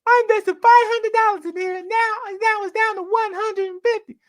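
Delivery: high panicked mock investor wailing about losses; freaking out. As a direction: squeal it like a trader watching his money vanish. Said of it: "freaking out".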